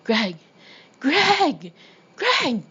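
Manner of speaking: from a firm call up to a high yell; acted search call, growing to a shout; yelling a name, building. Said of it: "I started yelling Greg's name".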